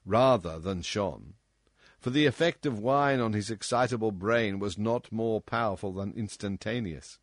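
usual speaking voice

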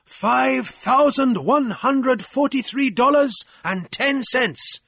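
high, loud public declaration; announcing. A narrator's reading. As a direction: announce it to the assembly. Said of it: "Jeremiah announced".

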